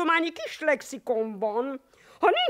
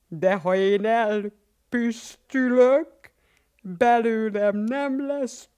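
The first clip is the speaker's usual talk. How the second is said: poem recital; 6 semitones lower than usual. Acted. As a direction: declaim it with mock dignity. Lószerafi recites his poem.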